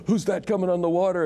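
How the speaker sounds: high, quick mock alarm; frightened disciples. Host retells the disciples seeing Jesus on the water, high quick mock fright.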